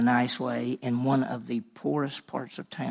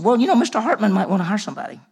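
chirpy secretary; 8 semitones higher, 9 dB louder, faster than usual. Retold or imitated. Retold secretary on phone.

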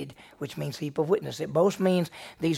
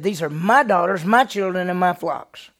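louder, higher, hitting each "my"; loud claim; 3 semitones higher, 9 dB louder than usual. Acted. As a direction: shout the claim, stressing "my". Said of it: "He said".

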